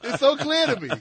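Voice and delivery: mock epiphany. Joking to co-host Curtis, a loud mock epiphany. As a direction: proclaim it like a hammed-up prophet.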